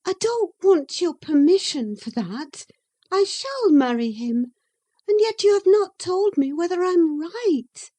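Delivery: put-on a young lady answering back, pitched high; Catherine. Catherine's voice in the reading.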